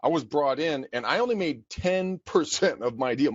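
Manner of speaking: ordinary talk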